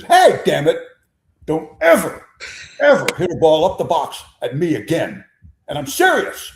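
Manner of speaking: loud, high mock fury at the batter; mock angry outburst. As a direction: chew him out loud, playing it serious. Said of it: "stalks toward the plate and says".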